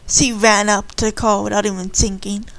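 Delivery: usual speaking voice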